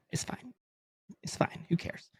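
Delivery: soft, dismissive it's fine; resigned shrug. Real, not acted. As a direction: mutter it off, giving up. Shrugging off a loss.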